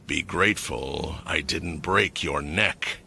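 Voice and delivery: low, soft, slow cold threat. A narrator's reading. Rick to the fallen bowman, coldly threatening. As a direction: ice in every word. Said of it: "Rick said coldly".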